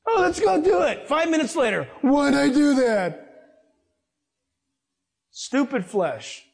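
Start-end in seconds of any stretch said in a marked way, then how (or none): 0.1-1.1 high, bright-eyed mock enthusiasm; eager mock cheer. Preacher mimics his eager past self, high and keen. 2.0-3.2 mock regret. Preacher voicing regretful self.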